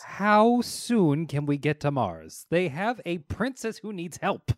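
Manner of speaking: mock-urgent plea. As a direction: declaim it like an urgent rescue call. Acted urgent plea, repeated take.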